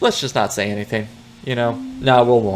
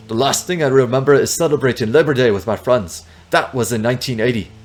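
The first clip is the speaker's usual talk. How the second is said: bad Russian accent; 3 semitones higher, 4 dB louder than usual. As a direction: fake Russian, eager and rising to a triumphant cry. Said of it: "that is a very bad Russian accent".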